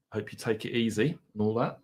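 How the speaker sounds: usual speaking voice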